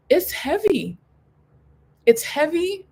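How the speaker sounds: rising, emphatic it's heavy. Real, not acted. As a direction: lift heavy up high for emphasis. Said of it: "stressed repeat heavy".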